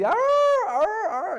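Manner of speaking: high mock dog howl, loud; dog whimper howl. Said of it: "Firulais está chillando así". (aullido de perro lloroso, agudo y fuerte)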